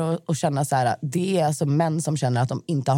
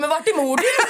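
high, laughing comeback; laughing retort; 10 semitones higher than usual. Retold or imitated. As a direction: fire it back mid-giggle. Retold own retort, laughing. (skrattande motfråga, högt)

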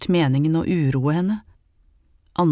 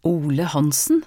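ordinary delivery

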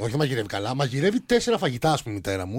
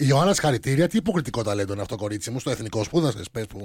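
mock admiration; 7 semitones lower than usual. Mock praise to co-host.

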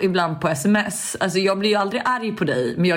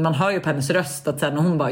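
ordinary delivery

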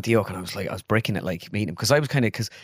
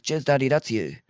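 imitated daughter; 3 semitones higher than usual. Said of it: "and she says".

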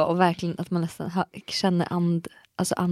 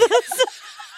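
very high laughing burst. Real, not acted. Friend joking about his breathing, high laugh. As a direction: crack up.

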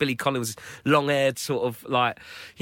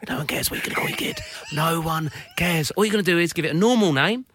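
mock ranting reply; 4 semitones higher than usual. Comedian sneering at parents hiding the baby's name, mock rant. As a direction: tear into the smug parent. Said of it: "And I'm like".

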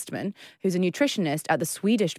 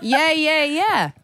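high, loud eager yeses; fake eager agreement; 8 semitones higher, 4 dB louder, much slower than usual. Shown as a demonstration. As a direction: nod along with false enthusiasm. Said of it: "Okay, sorry".

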